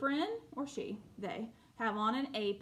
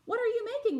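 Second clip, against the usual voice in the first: voiced character; 12 semitones higher, 5 dB louder, much faster than usual.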